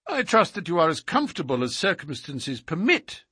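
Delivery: lightly voiced detective, mock-courteous; Holmes. A narrator's reading. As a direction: lightly play polite irony to a prisoner. Detective politely addresses bound captive.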